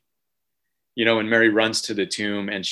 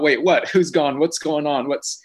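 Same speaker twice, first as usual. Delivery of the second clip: higher-pitched, alarmed questions; startled confusion; 6 semitones higher than usual. Retold or imitated. Dad on the couch answers his frantic boys, startled. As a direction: act out a baffled dad caught off guard.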